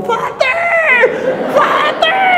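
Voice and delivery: high shrieking call. Retold or imitated. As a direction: yell for the priest across a crowd. Old lady calling across mall.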